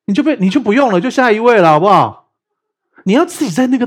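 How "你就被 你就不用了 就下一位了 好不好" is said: louder, higher, playfully fed up; loud mock exasperation. Teacher mocking a pastor who makes you wait, loud. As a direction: toss it off like a frustrated joke.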